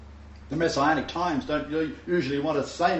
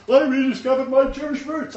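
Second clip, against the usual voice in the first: higher, louder mock-proud convert; mocking quote; 10 semitones higher, 6 dB louder than usual. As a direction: ham up the smug seeker. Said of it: "and he's, and he's".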